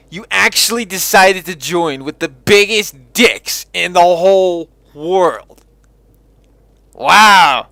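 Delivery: big, exasperated, mocking outburst; loud incredulous rant. Real, not acted. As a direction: berate them loudly, utterly appalled. Gamer berates characters for foolish alliance.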